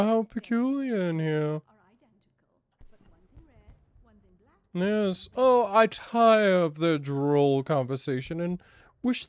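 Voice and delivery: hammed-up posh English accent, pitched high; shitty posh voice. Poshly voicing his own character to party guests, hammed-up English accent. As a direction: play a pompous English aristocrat touring a party. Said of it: "devolve into a posh version of myself".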